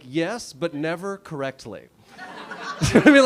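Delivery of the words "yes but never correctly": slow deadpan reply. Retold or imitated. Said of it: "I'm always like".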